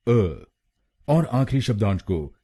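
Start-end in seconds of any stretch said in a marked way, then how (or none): 0.1-0.4 अ said as a short uh; a short neutral uh for अ; syllable demo. Shown as a demonstration. Teacher models the third syllable, uh. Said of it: "हम बोलेंगे अ".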